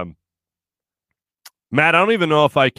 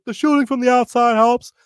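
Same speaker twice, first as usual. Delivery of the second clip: lightly done JoJo voice, higher and louder; a hint of the player's own way of talking; 8 semitones higher, 4 dB louder than usual. Host slips into a light JoJo impression while retelling. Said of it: "not do the JoJo voice".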